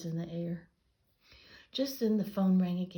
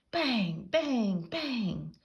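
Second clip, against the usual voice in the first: loud, higher bangs; banging noise; 5 semitones higher, 5 dB louder, much slower than usual. A narrator's reading. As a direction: hammer each bang out. Noisy twins banging.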